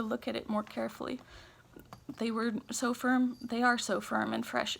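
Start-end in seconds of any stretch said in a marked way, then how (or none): none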